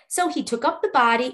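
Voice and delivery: ordinary delivery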